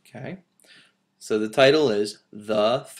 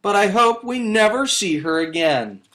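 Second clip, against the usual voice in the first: higher, louder motherly bear voice; a lightly voiced mother bear; Mama Bear; 8 semitones higher, 5 dB louder than usual. A narrator's reading. Teacher reading the story, Mama Bear in a raised voice. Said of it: "said Mama Bear".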